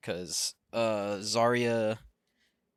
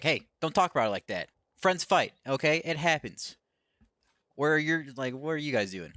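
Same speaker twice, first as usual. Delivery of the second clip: raised pitch, louder mock objection; retold indignant protest; 5 semitones higher, 4 dB louder than usual. Wrestler defending her friend to rivals, mock indignant. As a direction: imitate someone standing up for a friend, pitched up.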